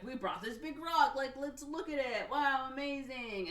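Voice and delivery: high, loud imitation of a showman; talk show host. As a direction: hype up the crowd like a TV host. Host shows rock to live audience.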